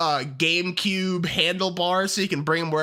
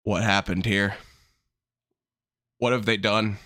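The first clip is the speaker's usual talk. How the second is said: low-pitched mock lament; hammed-up hushed disbelief, much lower; mock dismay; 9 semitones lower than usual. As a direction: mourn it in a low voice, playing up the tragedy. Mock lament over a ruined design.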